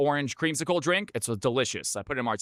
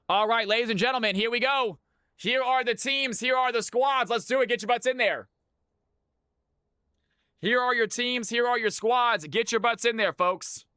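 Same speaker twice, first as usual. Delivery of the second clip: raised, excited host call; rally the players like an announcer; hype announcer; 6 semitones higher than usual. Acted.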